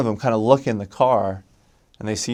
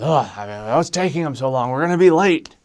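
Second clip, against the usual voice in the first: mock impatient whine climbing higher; fussing about a delay, rising in pitch and volume; 5 semitones higher, 4 dB louder than usual. Mission president stuck behind a riot, impatiently complaining. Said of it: "impatient kind of a guy".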